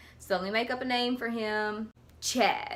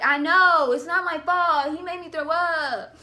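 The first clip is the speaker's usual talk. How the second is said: higher, louder indignant protest; defensive protest; 6 semitones higher, 5 dB louder than usual. Retold or imitated. Answering the jeering classmates, loud indignant protest. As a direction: insist it isn't your fault.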